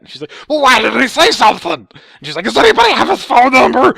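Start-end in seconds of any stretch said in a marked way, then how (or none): 0.5-1.9 high-pitched furious mock shouting; hammed-up screaming tantrum; freaking out. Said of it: "he starts freaking out". 2.4-3.9 high-pitched furious mock shouting; hammed-up screaming tantrum; freaking out. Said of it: "he starts freaking out".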